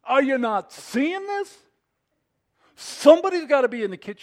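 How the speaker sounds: high, loud exasperated complaint; hammed-up outrage, building to a fast outburst; indignant Martha. Preacher playing Martha dumping on Jesus, loud indignant complaint. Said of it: "That was Martha saying".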